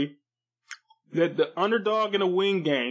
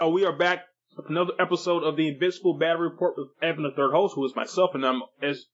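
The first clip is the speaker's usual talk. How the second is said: ordinary talk, nothing marked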